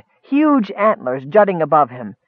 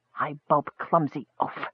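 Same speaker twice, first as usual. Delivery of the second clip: low-key, much softer mutter; muttered grumble; 3 semitones lower, 10 dB quieter than usual. A narrator's reading. Wife untangling her clumsy husband, muttering. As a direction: grumble it under your breath. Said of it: "she muttered".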